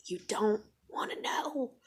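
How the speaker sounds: hushed, menacing quote; ominous warning. Retold or imitated. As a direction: re-enact warning them off in a whisper. Acts ominous warning to partner.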